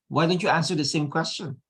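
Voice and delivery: normal talking voice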